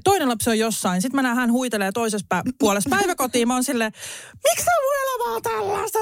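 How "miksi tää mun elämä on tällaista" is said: high, fast despairing whine; exasperated wail. Retold or imitated. Exasperated inner cry at daycare. (korkea epätoivoinen valitus)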